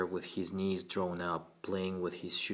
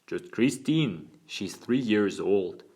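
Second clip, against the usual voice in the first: put-on slow, louder Cockney boy; crisp Cockney accent; 5 dB louder, slower than usual. A narrator's reading. Boy telling his lawyer about his sister, Cockney. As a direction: take it slowly in boyish Cockney. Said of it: "his crisp Cockney accent".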